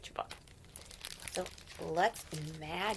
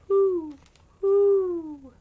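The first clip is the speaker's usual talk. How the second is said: loud high owl hoots, falling; 11 semitones higher, 16 dB louder than usual. Pretend. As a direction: hoot like an owl to the children. Owl noise in picture book.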